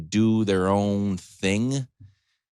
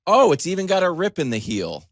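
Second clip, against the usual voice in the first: mock excited shopper; 8 semitones higher, 7 dB louder than usual. Mock shopper admiring old jeans.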